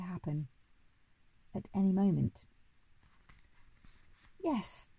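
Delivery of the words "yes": high, hesitant little yes; nervous murmur. A narrator's reading. Boy answers the Duke's offer of a wish, nervously. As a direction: venture it timidly, pitched up. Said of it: "I murmured nervously".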